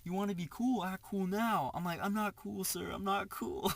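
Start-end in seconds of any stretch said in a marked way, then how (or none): 0.1-1.7 higher-pitched imitation of an aggressor; retold threat, pitched up; mock angry taunt. 2.0-3.7 high, meek imitation of yourself; mock-frightened surrender to an aggressor; retold scared plea. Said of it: "I was so scared".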